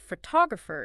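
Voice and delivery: "photographer" with the stress on its second syllable, TOG. Shown as a demonstration. Teacher explaining word stress, "photographer" stressed on the second syllable. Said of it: "is stressed on the second".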